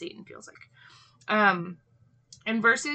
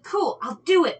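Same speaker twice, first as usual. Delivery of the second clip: eager Cain voice; 9 semitones higher, 7 dB louder than usual. Retold or imitated. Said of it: "and he's like".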